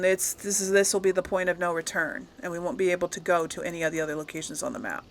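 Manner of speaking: normal talking voice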